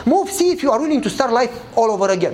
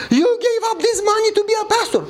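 much higher, stunned disbelief; astonished officer; 11 semitones higher than usual. Retold or imitated. Officer reading the salary book, stunned and high. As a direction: gasp it out in disbelief at the sacrifice.

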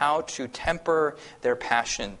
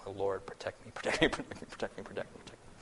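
fading muttered prayer, softer and softer; mock anxious prayer; 4 semitones lower, 11 dB quieter, faster than usual. Praying nervously while meeting atheists, fading to a mock hush. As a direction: pray under your breath, fading out. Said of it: "I'm, like, praying".

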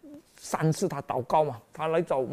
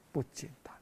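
whisper; 12 dB quieter, faster than usual. Real, not acted. Preacher drops to hushed aside.

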